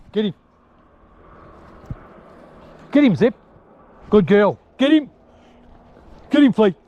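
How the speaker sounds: high, loud cry to the dogs; urging shout. Real, not acted. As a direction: yell them on after the rabbit. Urging the dogs after a rabbit.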